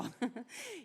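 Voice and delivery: a quick real laugh after the joke; short laugh. Real, not acted. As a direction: laugh lightly at the punchline. Laughs at own story. (risada curta)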